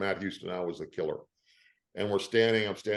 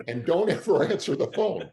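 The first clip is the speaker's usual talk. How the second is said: laughing scolding; 8 semitones higher, 4 dB louder than usual. Retold or imitated. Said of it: "Got it".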